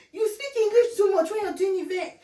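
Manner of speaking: high, loud mocking complaint voice; mocking a nagging critic. Mocking the woman who always trolls her. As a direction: mimic the troll's whiny jab. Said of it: "this person that's always trolling on me".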